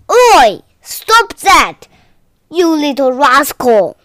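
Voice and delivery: loud scolding yell; angry shout. Acted. Grandpa shouting at snails eating his vegetables. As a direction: bark it at the little rascal.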